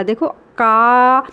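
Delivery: very slowly, का with a long open आ; sounding out का. Shown as a demonstration. Teacher to children, drawing out का to show आ. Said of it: "तो वो आ का स्वर देगा".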